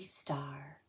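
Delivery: softly whispered word. Acted. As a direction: breathe it out in awe. Stars by the campfire.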